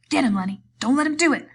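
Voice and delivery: loud, high urgent yell. A narrator's reading. George yelling at Lenny to fight back. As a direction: yell across the room to spur him on.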